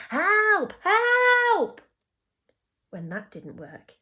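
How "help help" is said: high, full-force shout; long, high-pitched scream of a word; very loud cry for help. A narrator's reading. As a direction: yell desperately for rescue. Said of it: "then very loudly".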